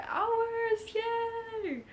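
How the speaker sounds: high, bright little cheer; excited cheer. Real, not acted. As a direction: celebrate the finish. End of challenge cheer.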